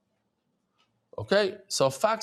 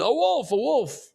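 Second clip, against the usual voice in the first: mock cry of alarm, much higher; retold scream; 7 semitones higher, slower than usual. Teacher retells his cry of wolf to the class, mock scream. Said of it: "I started screaming".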